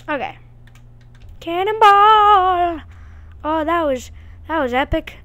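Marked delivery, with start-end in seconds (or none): 1.4-2.8 high, drawn-out yell; cannonball yell. Pretend. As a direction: shout it out as you jump. Jumping into water in game.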